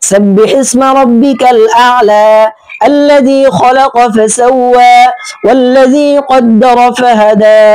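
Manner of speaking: slow melodic tajweed chanting; measured Quran recitation with drawn-out verse ends; Quranic recitation. Shown as a demonstration. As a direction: chant each verse slowly, stretching the endings. Recites Surah Ala.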